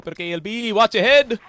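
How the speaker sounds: rising excited shout; esports caster. Real, not acted. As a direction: hype the play, loud and high. Caster hypes a live fight.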